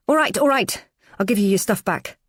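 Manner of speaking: loud, high giving-in shout. Acted. Beaten narrator shouting surrender at Teresa. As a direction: yell it out to make the beating stop. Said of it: "I shout".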